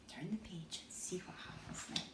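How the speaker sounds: soft whispered cue. Acted. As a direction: whisper it like a secret. Whispered page turn prompt.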